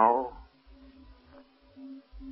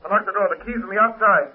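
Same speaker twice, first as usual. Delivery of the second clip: shouting through a door; 9 semitones higher, 3 dB louder than usual. Acted.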